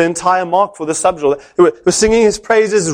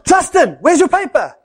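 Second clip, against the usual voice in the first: shouting the name; 11 semitones higher than usual. Retold or imitated. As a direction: call him out sharply. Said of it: "He shouts".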